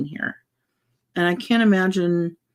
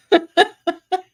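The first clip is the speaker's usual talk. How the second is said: a high, loud burst of real laughter; bright laugh; 11 semitones higher, 5 dB louder than usual. Real, not acted. Laughing while recalling sweet moment.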